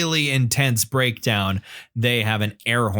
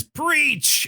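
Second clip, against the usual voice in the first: loud announcement. Retold or imitated. Metal singer announcing the guitar solo, loud announcement. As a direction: imitate a metal singer's high-pitched shout. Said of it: "loudly announces".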